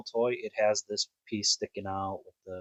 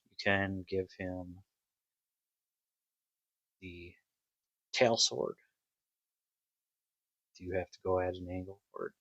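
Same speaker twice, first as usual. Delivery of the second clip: ordinary delivery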